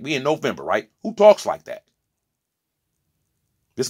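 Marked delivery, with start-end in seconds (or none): 1.0-1.8 much higher and louder indignant question; loud incredulous cry; outraged. Real, not acted. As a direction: shout your disbelief.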